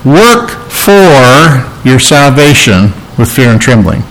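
FOR drawn out slowly as the wrong word; single out "for" with a long, deliberate stress. Shown as a demonstration. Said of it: "He does not say".

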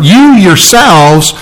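"you yourselves" said slowly with weight; slow and stress "you yourselves"; emphatic. Shown as a demonstration. Pastor repeating Paul's words, slow and emphatic. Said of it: "that's the emphatic part".

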